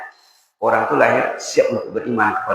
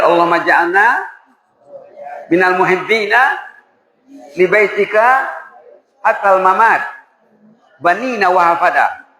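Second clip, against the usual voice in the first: a slightly raised chanting prayer; prayer recital; 6 semitones higher than usual. Shown as a demonstration. Preacher demonstrates the prayer for the congregation to repeat. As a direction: recite the prayer as a lead for the children. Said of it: "Ayo dibaca sama-sama".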